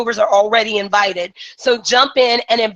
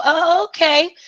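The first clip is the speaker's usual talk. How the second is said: confused; 6 semitones higher than usual. Retold or imitated. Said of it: "And they go".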